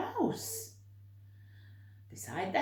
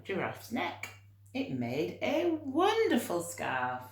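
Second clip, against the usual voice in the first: plain reading voice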